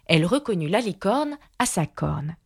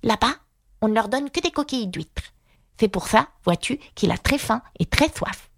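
higher character voice, confiding; murmuring confidant; 5 semitones higher than usual. A narrator's reading. Aïga tells Alice about Atta's prison, confiding. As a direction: pass on gossip in a raised, odd little voice. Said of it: "murmura Aïga à l'oreille d'Alice".

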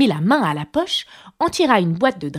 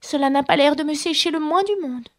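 put-on higher, dejected girlish voice; mildly forlorn and pitched up; melancholy little girl; 7 semitones higher than usual. A narrator's reading. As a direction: complain gloomily in a child's pitch. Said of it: "d'une voix mélancolique".

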